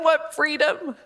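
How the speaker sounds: usual speaking voice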